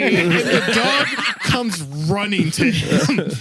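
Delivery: slow, laughing retelling. Real, not acted. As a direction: laugh through the ending. Laughing, telling payoff.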